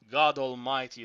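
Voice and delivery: usual speaking voice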